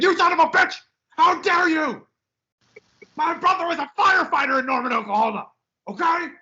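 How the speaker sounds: mock furious fan. Host imitating angry Oklahoma fans, mock screaming rant.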